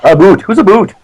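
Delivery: teasing echo, "boot" with an oo vowel; mock Canadian "boot". Shown as a demonstration. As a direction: parody about as "boot". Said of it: "people seem to think we say A and a boot".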